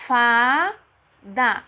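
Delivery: "Fada" loud, split as FA, pause, DA; syllable by syllable. Shown as a demonstration. Teacher counting syllables for children, "Fada" in two separate pieces. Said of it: "quantas vezes eu vou abrir a minha boca".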